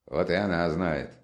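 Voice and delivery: usual speaking voice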